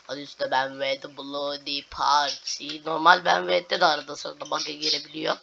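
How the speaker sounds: ordinary talk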